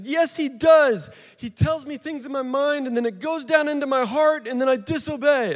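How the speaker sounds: put-on little boy voice. Son tells dad Satan makes him disobey, high child voice. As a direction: whine it like a four-year-old blaming the devil.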